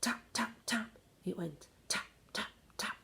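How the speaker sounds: quiet, breathy tap sounds; boy tapping on the door; soft tapping. A narrator's reading. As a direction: whisper the taps.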